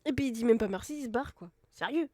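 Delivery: loud, indignant complaint; indignant outburst. Real, not acted. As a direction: blurt it out, outraged. Indignée que l'animal parte. (indignée, à voix forte)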